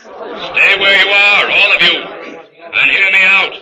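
freeze them where they stand; commanding ghost voice. Acted. Ghostly avenger commands the seance.

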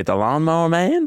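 bad, hammed-up Australian accent with a rising question; a mock Aussie twang on the film title; terrible Australian accent. "translating" the title for her, in a bad Australian accent. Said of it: "That was a terrible Australian accent".

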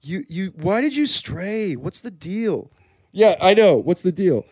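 usual speaking voice

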